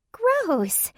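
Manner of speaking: high squealing playful disgust; theatrical squeal. Acted. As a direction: squeal it, hamming it up. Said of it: "uttered a theatrical squeal".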